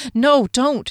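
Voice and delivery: sharp order. A narrator's reading. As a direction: stop him short, firmly. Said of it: "he ordered".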